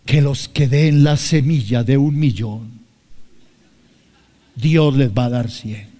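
slow, put-on voice of a greedy preacher; mock false prophet. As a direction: drag it out like a swindling prophet. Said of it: "generalmente Dios me dijo". (imitando lento a un falso profeta codicioso)

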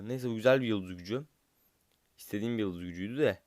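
normal talking voice

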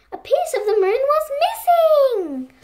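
high and loud, a gasp of alarm; shocked reveal. A narrator's reading. Reader gasps at the missing moon, high and loud.

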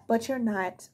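ordinary talk

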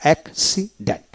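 Accident broken as অ্যাক-সি-ডেন্ট; teacher's split-up model of accident, gaps between parts; syllable by syllable. Shown as a demonstration. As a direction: read accident in three separate syllables. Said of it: "এইভাবে".

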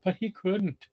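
put-on lively read-aloud for children; animated storybook reading; story narration. A narrator's reading. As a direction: tell it like a picture book to kids. Reading picture book aloud.

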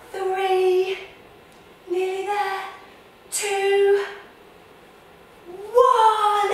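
a loud high rocket countdown building to a drawn-out blast off. Acted. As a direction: count down to launch, rising to a sung blast off. Countdown to launch.

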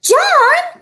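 put-on high, loud cry of the boy's name; embarrassed mother's outburst; mother's sharp exclamation. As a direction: call him out in shock. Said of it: "exclaimed Missus Midas".

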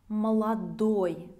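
Молодой with the stress on the last syllable, ДОЙ; stress on the ending. Shown as a demonstration. Teacher shows students Молодой stressed at the end. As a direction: say Молодой slowly, landing on the final ОЙ. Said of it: "notice that the stress will change".

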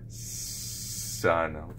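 soft sun with a held S; quiet careful sun; child saying sun. Retold or imitated. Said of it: "they'll go".

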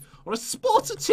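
high, put-on English accent; silly high English voice. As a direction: warm up a mock English accent, pitched high. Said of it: "get my English accent on".

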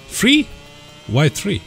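squeaky high disbelieving three; surprised. Real, not acted. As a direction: shoot the word up in shock. Incredulous at request.

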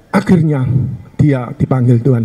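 ordinary talk

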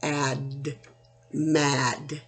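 put-on segmenting for children; sounding out. Shown as a demonstration. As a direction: softly blend the sounds of ad then mad. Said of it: "this says m".

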